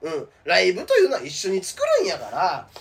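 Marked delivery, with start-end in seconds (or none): none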